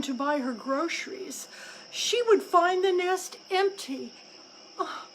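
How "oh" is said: high, anxious bird voice; high, fretting crow; fretful mother crow. A narrator's reading. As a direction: wail it like a worried mother crow. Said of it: "she would say, as she hunted".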